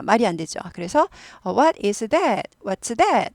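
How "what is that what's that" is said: "that" falling at the end; falling tune. Shown as a demonstration. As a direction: let the pitch drop on "that". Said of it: "하고 내려서 물어봅니다".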